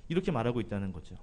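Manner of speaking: ordinary talk, nothing marked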